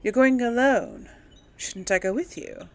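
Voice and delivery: normal talking voice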